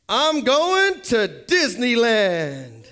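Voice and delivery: victorious athlete's slow, drawn-out proclamation; Phil Simms. Acted. Winning quarterback answering the interviewer on camera, slow and triumphant. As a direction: stretch every word out in a big announcement. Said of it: "the camera went right to Phil Simms".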